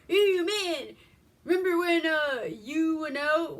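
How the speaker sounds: mock Southern drawl, loud and high. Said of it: "They're talking in a southern drawl".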